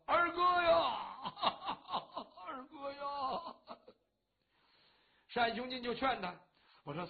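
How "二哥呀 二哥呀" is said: slow, drawn-out wailing sob on 二哥呀; theatrical storyteller's wail for his brother; wailing cry. Acted. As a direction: stretch out 二哥呀 as a hammed-up lament, sobbing between. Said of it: "人又哭上了".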